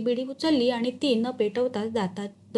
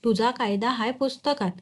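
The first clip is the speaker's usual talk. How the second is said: plain reading voice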